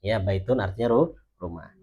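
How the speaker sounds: normal talking voice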